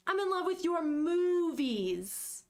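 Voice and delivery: very slow, stretched-out correction; exasperated clarification. Acted. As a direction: drag out the words to set them straight. Acted exasperated clarification, first take.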